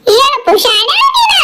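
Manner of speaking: slow, loud yell at him; angry shout. Acted. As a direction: bellow the insult slowly. Acted quarrel, shouting at someone.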